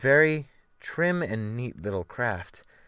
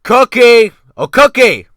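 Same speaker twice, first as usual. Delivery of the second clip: loud, high call across the deck; 12 semitones higher, 16 dB louder than usual. Acted. Captain calling the cook from the deck, loud shout. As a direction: bellow for the cook at full voice. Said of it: "cried out".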